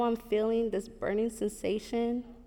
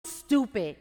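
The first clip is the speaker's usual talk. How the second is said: loud, high-pitched acted tirade; upset, angry berating; 5 semitones higher, 7 dB louder than usual. Program director berating his manager over stapled papers, angry. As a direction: chew someone out, punching the key words. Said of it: "why are you so upset".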